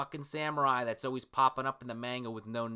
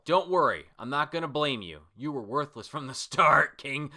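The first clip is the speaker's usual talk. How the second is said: raised, higher voice of a scolding villain; loud, contemptuous manga dub; angry berating; 5 semitones higher, 6 dB louder than usual. Acted. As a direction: berate a worthless underling. Acting out an angry manga villain's dialogue.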